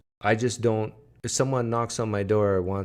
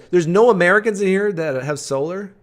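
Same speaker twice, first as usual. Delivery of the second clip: much louder and higher, exclaiming; loud disbelief; 9 semitones higher, 10 dB louder than usual. Real, not acted. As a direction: call it out in mock outrage. Exclaiming to chat about solar.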